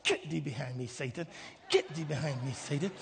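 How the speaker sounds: low, hushed banishing voice; mock rebuke of Satan. Believer mistakes God's nudge for the devil, low muttered rebuke. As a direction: mutter it low like warding off temptation.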